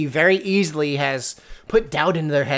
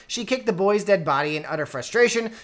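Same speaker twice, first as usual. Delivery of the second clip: ordinary talk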